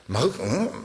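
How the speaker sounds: normal talking voice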